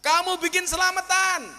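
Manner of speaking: loud, high sermon voice; preaching. Acted. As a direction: preach it out to the crowd. Preaching at full force. (ceramah berapi-api dengan suara keras tinggi)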